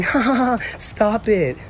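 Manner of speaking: mock giggle. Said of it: "And she's saying".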